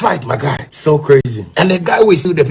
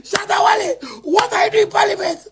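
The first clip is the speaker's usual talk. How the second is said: mock shout; 11 semitones higher than usual. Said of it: "I said".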